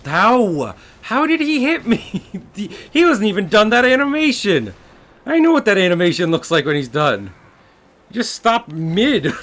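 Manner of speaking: high, loud, frustrated outburst; raging gamer. Real, not acted. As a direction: yell at the game in disbelief. Raging at boss hit.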